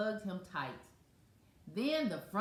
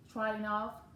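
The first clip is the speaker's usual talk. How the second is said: ordinary delivery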